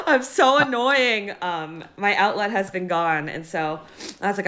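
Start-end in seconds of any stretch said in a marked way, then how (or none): 0.0-1.3 laughing through the words, slow and higher; self-mocking laugh. Real, not acted. Guest laughing at herself over bothering her family, self-mocking laugh. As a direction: giggle as you admit it.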